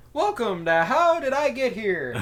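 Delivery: game show host. Retold or imitated. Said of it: "the host goes".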